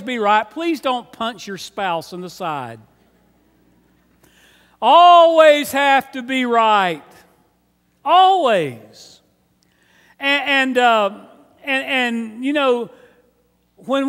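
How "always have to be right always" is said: "Always" hit loud and stretched out; loud, drawn-out emphasis. Real, not acted. Pastor needles those who must be right, loud stress on "Always". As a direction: lean hard and slowly on "Always". Driving home the need to be right.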